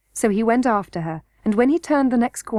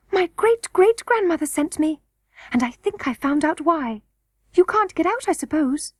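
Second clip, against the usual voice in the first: high-pitched little-princess voice; young princess Irene, eager; 7 semitones higher than usual. A narrator's reading. Princess explains to the trapped boy why she came, high-voiced.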